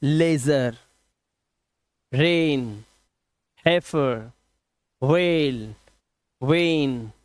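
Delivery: one word at a time, clear "ay" in रेन and वेन. Shown as a demonstration. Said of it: "ईआई का उच्चारण ए".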